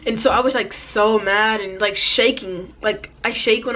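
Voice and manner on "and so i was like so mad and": ordinary talk, nothing marked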